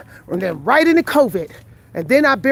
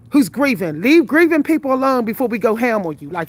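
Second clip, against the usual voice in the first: ordinary delivery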